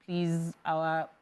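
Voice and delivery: ordinary delivery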